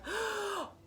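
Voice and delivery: quick high intake of breath; deep breath gasp. Acted. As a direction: suck in a big breath. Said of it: "took a deep breath".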